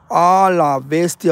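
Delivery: loud, long-drawn exclamation of awe; real amazement. Real, not acted. Streamer reacting to the ghost image, amazed. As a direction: stretch it out, stunned and loud.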